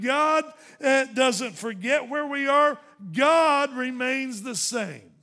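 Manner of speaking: loud, slow, hammering each line; full-force preaching. Acted. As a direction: preach it out, every phrase a blow to the pulpit. Preaching builds through repeated lines.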